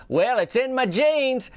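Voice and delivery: high, drawn-out joke payoff; corny punchline. Retold or imitated. Doreen telling her goldfish the joke's payoff, corny punchline.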